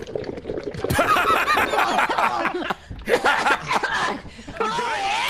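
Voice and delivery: high shouting laugh. Real, not acted. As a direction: explode into laughter. Bong water joke.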